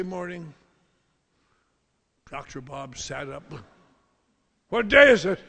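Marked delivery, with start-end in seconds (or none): 4.7-5.4 loud, high panicked question; startled shout. Retold or imitated. Hungover doctor waking, loud high panic. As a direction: jolt awake in alarm.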